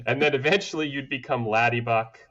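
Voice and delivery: normal talking voice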